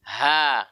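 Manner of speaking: HA with a light, open vowel; clean, unthickened HA; thin ha. Shown as a demonstration. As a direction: breathe out a thin "ha". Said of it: "Padahal huruf tipis".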